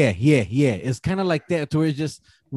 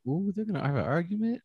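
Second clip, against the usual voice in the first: higher, softer gossipy ooh; nosy drive-thru girl; 6 semitones higher, 7 dB quieter than usual. Retold or imitated. Man imagines the drive-thru worker's gossipy thought, higher and softer. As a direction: mimic her nosy whisper-thought. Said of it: "she's probably thinking like".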